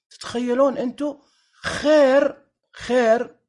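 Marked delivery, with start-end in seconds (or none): 1.6-3.3 خير punched higher and louder, twice; stress on خير. Shown as a demonstration. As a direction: hit the word خير hard. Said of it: "أقوى صيغة مبالغة".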